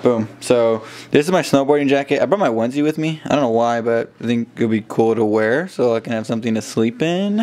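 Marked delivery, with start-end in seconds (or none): 3.8-7.4 statement ending on a rising question tune. Real, not acted. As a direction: let the end lift up like you're asking. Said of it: "I had to say it as a question".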